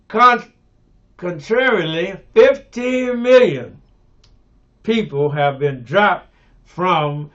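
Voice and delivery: loud, high, outraged preacher's cry; fiery preaching. Acted. Preacher decrying the snap cuts to his congregation, in a loud, high, outraged cry. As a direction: thunder the numbers at the congregation.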